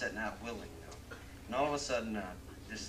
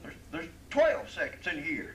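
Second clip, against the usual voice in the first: cowboy answer; 7 semitones higher, 9 dB louder than usual. Acted. Cowboy gives absurd answer.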